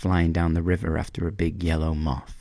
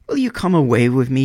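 higher, louder bird voice, hopeful; a little bird begging her to fly off; pleading swallow; 7 semitones higher, 6 dB louder than usual. A narrator's reading. Swallow begs the reed to leave, higher hopeful bird voice.

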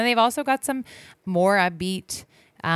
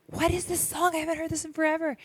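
surprised; 11 semitones higher, 4 dB quieter, faster than usual. Retold or imitated. Surprised at hearing old song.

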